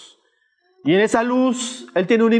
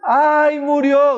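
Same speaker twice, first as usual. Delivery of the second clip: louder, higher wail of grief for the dead; imitated mourners' lament; mock funeral wail; 4 semitones higher, 3 dB louder, much slower than usual. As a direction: cry out like mourners following a coffin. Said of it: "la gente decía".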